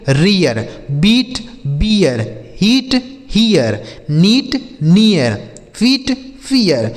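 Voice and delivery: word pairs said slowly, ee then ee-ya; slow drill of रियर, बीट, हियर, नियर, फीट, फियर; teacher reading the word list slowly to learners; pronunciation drill. Shown as a demonstration. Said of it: "देखिए जैसे हमने पढ़ा".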